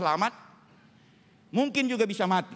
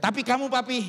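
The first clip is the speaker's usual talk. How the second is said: lightly acted wife, pitched higher; wife's concerned question; 5 semitones higher than usual. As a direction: ask as a concerned wife, mildly dramatized. Wife's anxious question acted in story. (tiruan istri bertanya cemas)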